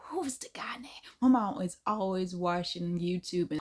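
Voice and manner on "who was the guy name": hushed, half to yourself; whispering to self. Real, not acted. Vlogger trying to recall a YouTuber's name, whispered. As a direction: whisper it while searching memory.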